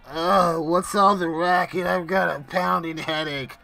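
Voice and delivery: a lightly voiced hungover brute, groaning awake; groggy grumble. Groggo wakes to gunfire, a mildly hammed-up hungover groan. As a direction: grumble like you were just woken with a headache. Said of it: "Groggo sits up at this point going".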